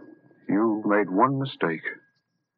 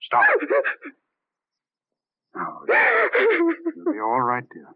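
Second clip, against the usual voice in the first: tearful comfort; slower than usual. Acted. Comforting the sobbing wife.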